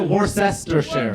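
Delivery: Worcestershire slowly as wor-SESS-ter-share; spelled-out mispronunciation. Shown as a demonstration. Host correcting an audience member, Worcestershire as wor-sess-ter-share. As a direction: say Worcestershire syllable by syllable, every part sounded. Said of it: "No, you pronounce it".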